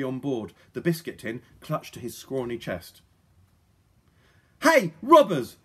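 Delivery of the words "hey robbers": put-on high, loud girl's challenge; shout. A narrator's reading. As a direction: shout to stop the robbers. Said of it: "shouted Polly".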